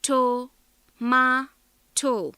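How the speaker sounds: TO, MA, TO, each part said alone and loud; syllable by syllable. Shown as a demonstration. Teacher counts out three syllables, syllable by syllable. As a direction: break tomato into separate, firm syllables. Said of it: "three syllables".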